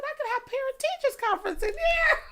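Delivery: very high, loud retold exclamation; amused exclamation. Retelling a look round an after-hours party, amused shout. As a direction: cry it out for the laugh.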